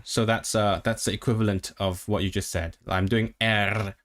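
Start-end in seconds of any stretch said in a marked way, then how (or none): 3.4-3.9 drawn-out vowel into a rolled "R"; trilled "R" after a vowel; vowel plus R. Shown as a demonstration. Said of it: "vowel plus R".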